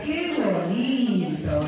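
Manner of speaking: very drawn-out happy amazement; alegría y sorpresa. Shown as a demonstration. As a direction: stretch the words in exaggerated delight. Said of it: "De alegría, de sorpresa". (asombro alegre, muy alargado)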